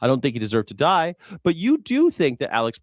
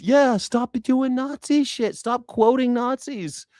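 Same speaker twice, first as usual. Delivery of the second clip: high, slow, hammering each word; much higher, drawn-out exasperated scolding; exasperated slow rant; 8 semitones higher, slower than usual. Real, not acted. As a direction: lay it down word by word, fed up. Furious rant at Nazis.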